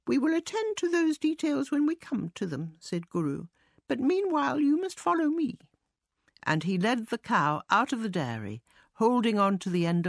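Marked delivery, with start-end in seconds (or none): 0.1-2.8 high-pitched mouse brushing details aside; self-important mouse. A narrator's reading. 3.9-5.6 high-pitched mouse brushing details aside; self-important mouse. A narrator's reading.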